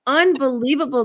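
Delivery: ordinary delivery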